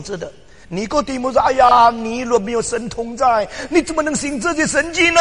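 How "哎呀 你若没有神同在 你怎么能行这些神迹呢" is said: loud, high, fawning praise; voicing Nicodemus. Preacher voicing Nicodemus praising Jesus, loud and high. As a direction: lay on the flattery, pitched up.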